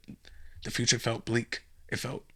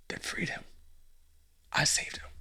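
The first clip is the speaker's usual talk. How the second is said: whispered confession; 3 semitones higher, 5 dB quieter than usual. Acted. Confessing he saved Timmy.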